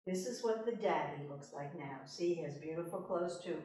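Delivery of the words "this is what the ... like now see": ordinary delivery, nothing marked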